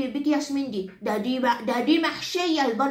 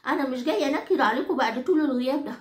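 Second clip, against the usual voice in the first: ordinary talk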